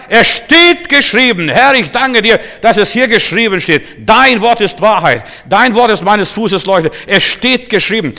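high, loud scriptural declaration; bold proclamation. Acted. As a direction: proclaim each line as unshakeable truth. Models declaring scripture to devil.

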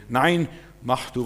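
normal talking voice

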